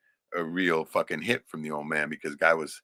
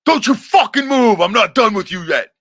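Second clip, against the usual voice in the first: much higher, louder, slow bellowing rage; a snarling father's yell, pitched way up; father's furious yell; 14 dB louder than usual. Retold or imitated. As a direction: roar it like the drunk father mid-beating. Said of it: "Two different fucking speaking voices".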